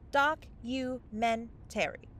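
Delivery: slow, deliberate word for someone who doesn't get it; spelled-out slowly. Acted. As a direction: stretch out documentary syllable by syllable. Explaining a word to a fae.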